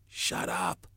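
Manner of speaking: high-pitched acted shout for silence. A narrator's reading. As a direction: cry out to quell the uproar. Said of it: "Ralph shouted".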